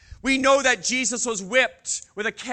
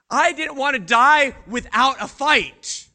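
louder and higher, fighting back the fear; defiant outcry; 5 semitones higher, 6 dB louder than usual. Acted. Peter to the crowd, a defiant loud cry.

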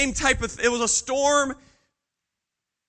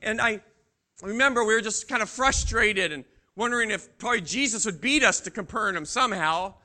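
ordinary talk

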